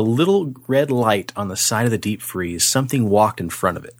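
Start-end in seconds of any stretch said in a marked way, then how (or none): none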